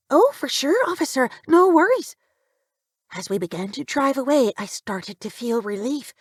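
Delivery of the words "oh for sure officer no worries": put-on high, obliging young woman; young woman's voice. A narrator's reading. Young driver agrees to help officer, high and willing.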